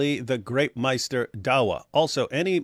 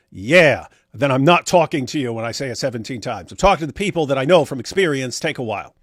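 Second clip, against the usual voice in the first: ordinary talk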